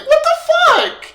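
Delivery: very high, loud acted shout; outraged yell. Soda yelling after the deserter, outraged mock shout. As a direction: imitate someone screaming in disbelief at being abandoned. Acts Soda's outrage at being abandoned.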